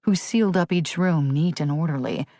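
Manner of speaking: plain reading voice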